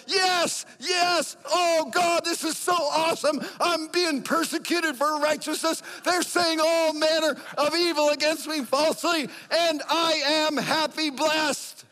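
exultant shouted praise in the little room; ecstatic praise. Retold or imitated. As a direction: shout it like you just won everything. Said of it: "I'm going like".

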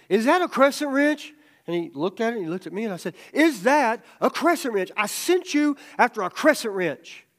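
loud, high, mock-stern dad questioning. Father quizzes son who brought wrong wrench, loud mock-stern. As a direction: demand it like a dad testing his son.

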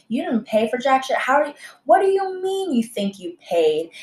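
retold indignant challenge, pitch jumping up. Waitress confronting a customer who ran off, indignant. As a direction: throw the accusation back, voice climbing in disbelief.

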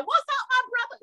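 high, playful mock-casual "What's up, my brother?"; casual buddy greeting. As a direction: greet the president like a cousin, jokingly. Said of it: "I wanted to be like".

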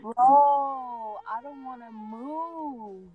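slow, drawn-out, too comfy to move; slow lazy comfort; lazy drawl. Real, not acted. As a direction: stretch it out lazily like sinking into a seat. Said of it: "most comfortable spot ever".

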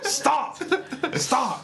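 high, shouted American stop; American accent shout. Shown as a demonstration. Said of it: "in American accent".

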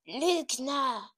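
sharp loud shout. A narrator's reading. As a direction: yell the accusation. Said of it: "denn sie schrie".

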